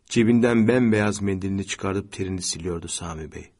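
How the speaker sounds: usual speaking voice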